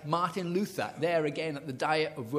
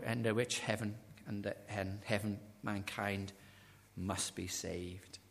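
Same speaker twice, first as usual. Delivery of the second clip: sinking lower and softer, slowing to a hush; hushed solemn; 8 semitones lower, 9 dB quieter than usual. Retold or imitated. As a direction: let the verse fade to a reverent hush. Solemn quote fading to hush.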